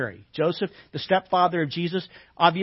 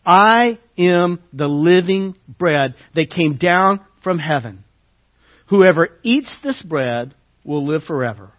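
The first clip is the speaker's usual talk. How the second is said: loud, measured preaching read; scripture reading; 2 semitones lower, 4 dB louder than usual. Acted. Preacher reading Jesus' words to the congregation, proclaiming.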